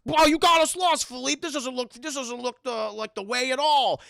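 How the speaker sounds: high, loud mock rant at the horse; mad. Maurice blaming Philippe for getting lost, mad. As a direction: blow up at your guide in exasperation. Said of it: "Maurice gets mad".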